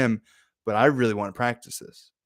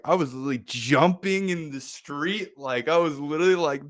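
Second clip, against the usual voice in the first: ordinary talk, nothing marked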